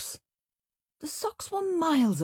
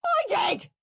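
high screamed retort; 3 dB louder than usual. A narrator's reading. Henry contradicting his brother, screamed. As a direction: scream back like a squabbling child. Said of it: "screamed Henry".

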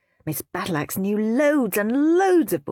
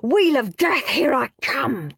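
higher, louder gleeful boast; triumphant; 7 semitones higher, 4 dB louder than usual. A narrator's reading. Henry dreaming of the theme park ride, triumphant. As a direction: crow with triumph. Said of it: "thought Horrid Henry".

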